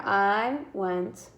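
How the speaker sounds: very slow word-by-word "I went on a run"; teacher counting words in a sentence. Shown as a demonstration. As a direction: tap out each word slowly. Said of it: "I have my five spaces".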